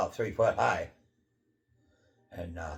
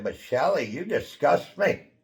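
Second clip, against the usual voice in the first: slow, raised, disgusted; slow scornful higher line; 5 semitones higher, 5 dB louder, slower than usual. Retold or imitated. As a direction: spell out each word of contempt. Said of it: "and I said".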